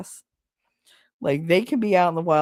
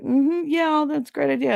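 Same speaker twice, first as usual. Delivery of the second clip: high-pitched, placating fake agreement; mock agreement; 8 semitones higher than usual. Others brushing off her ideas, mock agreeable. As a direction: humor them with a higher-pitched yeah.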